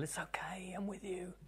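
tender unvoiced comfort; gentle whisper. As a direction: hush like a hand on the saddle. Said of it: "she said so gently".

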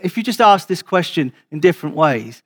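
usual speaking voice

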